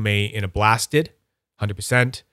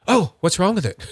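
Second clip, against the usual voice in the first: high-pitched startled reaction, played up; mock alarm; 8 semitones higher than usual. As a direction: act taken aback, voice jumping higher. Acts reaction to others' triangles.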